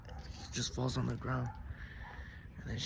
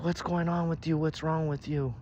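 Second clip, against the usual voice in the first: raised, louder questioning; alarmed confrontation; 6 semitones higher, 6 dB louder than usual. A narrator's reading. Punched man confronts the witch, loud upset questions. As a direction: demand to know what has got into her.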